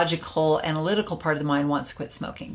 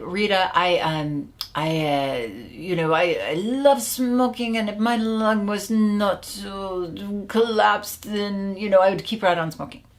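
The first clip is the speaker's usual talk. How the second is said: bad French accent; 3 semitones higher than usual. French client confessing to the coach, bad French accent. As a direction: imitate a Frenchman hesitating, badly faked accent. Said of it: "bad French accent".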